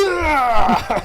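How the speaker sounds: high, loud mock yell from the doctor; hammed-up startled shout as he grabs the head. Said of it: "And he just goes".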